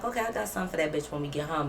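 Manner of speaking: muttered thought. Retold or imitated. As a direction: mutter it to yourself. Her inner thought, plotting.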